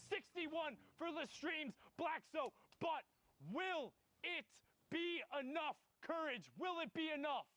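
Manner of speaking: rising excitement, hype play-by-play; esports caster. Acted. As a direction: call the final fight live. Live play-by-play climax.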